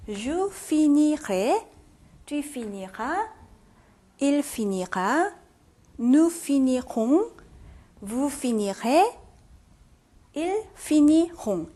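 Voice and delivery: slow chant from je finirai to ils finiront, syllables separated; conjugation drill. Shown as a demonstration. Teacher runs through 인칭별로 endings, slow pronunciation drill. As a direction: recite each form from je finirai on, drawn out with pauses between. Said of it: "인칭별로 다 변".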